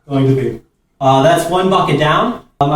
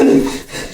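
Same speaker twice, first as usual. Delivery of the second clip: breathless laugh. Real, not acted. After ice bucket.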